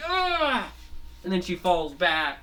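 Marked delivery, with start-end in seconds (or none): 0.1-0.7 high, loud pained yelp; pained cry. Retold or imitated. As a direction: yelp as if hit. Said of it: "she's like".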